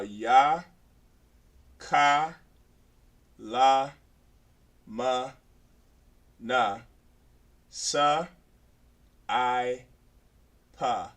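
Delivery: each syllable held, with a pause after it; measured letter drill. Shown as a demonstration. Teacher giving the class the next eight, measured spaced-out recitation. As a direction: recite one letter at a time, evenly spaced.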